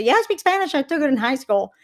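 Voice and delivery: high-pitched mock of an American bragging about school Spanish. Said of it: "they say".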